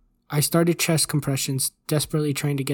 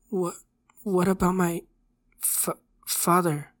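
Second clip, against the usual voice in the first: put-on halting, higher, tearful boy; holding back tears; 4 semitones higher than usual. A narrator's reading. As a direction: stammer it slowly, fighting tears. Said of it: "trying to hold back tears".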